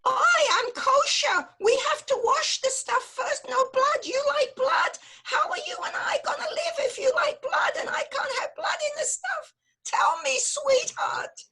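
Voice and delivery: worried Yiddish mama, building to cries; yiddish accent. Acted.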